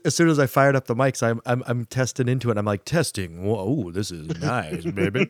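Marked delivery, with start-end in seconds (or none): 2.9-5.3 sultry voice. Retold or imitated. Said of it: "nice sultry microphone voice".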